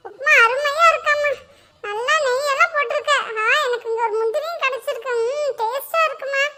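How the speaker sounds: put-on a light childlike voice praising the food; sweet child voice. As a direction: coax mother with praise. Son praises mother's upma.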